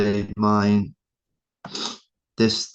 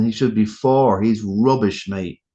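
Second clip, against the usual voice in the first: ordinary delivery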